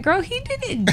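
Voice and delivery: high-pitched, laughing protest; amused disbelief. Retold or imitated. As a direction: exclaim it high, tickled by the absurdity. Mock disbelief at sister's eulogy.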